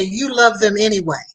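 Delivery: usual speaking voice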